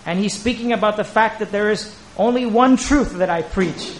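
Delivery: normal talking voice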